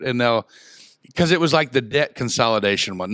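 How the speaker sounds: ordinary talk, nothing marked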